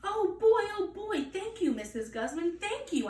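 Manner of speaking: high, squealing thanks; little girl squealing thank you to Mrs Guzman. Acted. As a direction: squeal with pure joy. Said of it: "I squealed".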